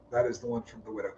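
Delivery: a soft musing repeat; quiet echo. Real, not acted. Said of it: "repeats line, second quieter".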